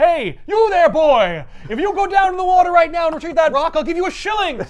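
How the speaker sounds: put-on loud, high old-fashioned shout; old-timey gent. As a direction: hail the boy like a Victorian toff. Calling a boy for coins.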